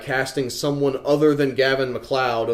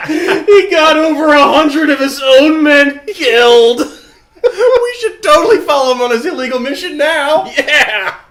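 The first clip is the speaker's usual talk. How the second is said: manic laughing shout; 12 semitones higher, 9 dB louder than usual. Real, not acted. Laughing hysterically at backstory.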